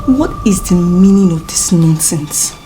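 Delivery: low, slow, soft demand; restrained outrage. Acted. Woman demanding an explanation, quietly outraged. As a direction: hold the anger down, slowly. Stunned demand after being hit.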